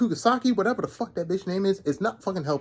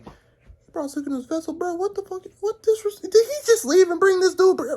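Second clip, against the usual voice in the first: high-pitched baffled outrage; shocked disbelief; 12 semitones higher, 3 dB louder than usual. Retold or imitated. Character shocked his rival came back. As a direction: squeak out the shock.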